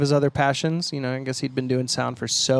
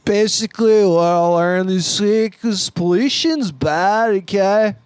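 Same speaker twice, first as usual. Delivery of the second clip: loud, slow mock drunk; hammed-up drunk drawl, pitched higher; 6 semitones higher, 5 dB louder, slower than usual. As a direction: stretch it out like a drunk scientist. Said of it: "That's the drunk voice".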